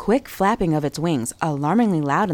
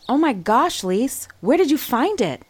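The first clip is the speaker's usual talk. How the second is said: higher, thrilled exclamation; excited cry; 7 semitones higher than usual. A narrator's reading. Maya seeing the silver dollar, excited cry. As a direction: burst out in delight. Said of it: "Maya cried".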